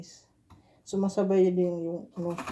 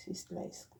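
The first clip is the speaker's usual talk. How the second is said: whisper; 14 dB quieter than usual. Real, not acted. Aside while slicing eggs.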